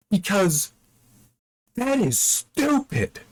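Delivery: very high, drawn-out incredulity; exasperated disbelief. Real, not acted. Reader fed up with clever Elsie's story, exasperated disbelief. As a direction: stretch it out high in utter disbelief.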